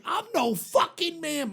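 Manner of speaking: scornful mock retort; indignant mother. Mother snaps at the radio DJ, indignant imitation.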